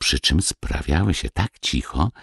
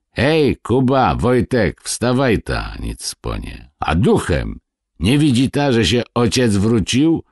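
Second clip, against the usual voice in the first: raised-voice storyteller's shout, higher in pitch; shouting at children; 7 semitones higher than usual. A narrator's reading. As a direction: call out to rouse the boys, mildly hammed-up. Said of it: "i na dzieci krzyknął". (umiarkowany krzyk na dzieci)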